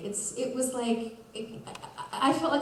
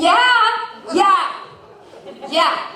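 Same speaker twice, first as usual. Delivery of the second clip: high, loud frantic yeahs fading down; slipping hiker answers in loud panic; panicked yes; 9 semitones higher, 5 dB louder, much slower than usual. Retold or imitated. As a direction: gasp out agreement in panic. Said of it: "And I'm like".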